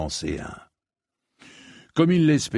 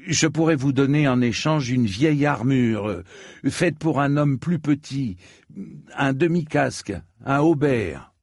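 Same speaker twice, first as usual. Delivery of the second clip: higher voice, offering item by item; a young customer proposing a trade, pitched up; lightly voiced bargaining knight; 6 semitones higher than usual. Knight offers old armour in exchange, higher-pitched voice.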